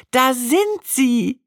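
high, slow, disbelieving exclamation; drawn-out gasp of disbelief, pitched high; stunned cry. Retold or imitated. Wife finding her old diaries, stunned and high-pitched. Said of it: "rief ich fassungslos".

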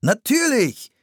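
high, emphatic exclamation; called out. A narrator's reading. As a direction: exclaim it eagerly. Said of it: "rief der Mann".

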